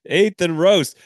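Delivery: high, punchy sponsor name; announcer echo. Pretend. Host echoing the plug in ad voice. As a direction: ring it out like a jingle.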